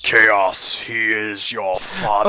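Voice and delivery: slow, heavy Star Wars father reveal; dramatic movie-villain reveal, slow and weighty; Vader reveal. Pretend. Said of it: "Star Wars reveal parody".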